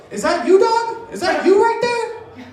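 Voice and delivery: high, slow drawn-out guy at the bar; creepy bar guy. Retold or imitated. Stranger at a bar leering at my girlfriend, high and slow. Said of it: "He goes".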